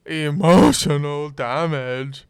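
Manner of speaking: slow put-on accent; meme catchphrase voice. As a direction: stretch it out, hammy.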